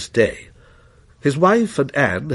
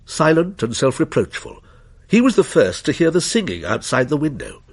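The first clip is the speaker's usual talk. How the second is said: usual speaking voice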